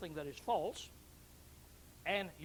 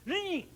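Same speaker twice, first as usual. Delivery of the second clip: high, loud "zing" like an engine kicking in; imitated car zing; 13 semitones higher, 9 dB louder than usual. Showing the congregation a car's overdrive, loud high zing. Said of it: "it goes".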